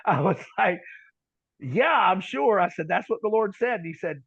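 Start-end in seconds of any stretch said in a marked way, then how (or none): 1.6-3.8 higher, louder assurance; insistent reply. Retold or imitated. Preacher assuring his doubting interpreter, insistent. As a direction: insist on it, pitched up and forceful.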